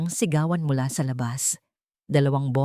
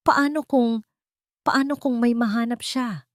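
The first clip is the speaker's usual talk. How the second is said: higher-pitched worry, halting after the first try; touch of panic; 7 semitones higher than usual. A narrator's reading. Marco fretting to his mother about the reporter, a touch of panic. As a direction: voice a mild, rising panic. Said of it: "may bakas ng panik sa kanyang boses".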